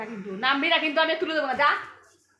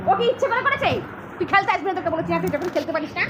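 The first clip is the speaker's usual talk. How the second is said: higher, louder telling-off; scolding; 5 semitones higher, 6 dB louder than usual. Real, not acted. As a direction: scold the cat for ruining the game. Scolding the cat. (বিড়ালকে বকুনি)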